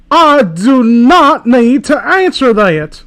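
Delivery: slow, pompous refusal; pretentious. Acted. Fanfic hero refusing the villain, slow and pompous. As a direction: refuse like a snob. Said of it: "pretentious twat".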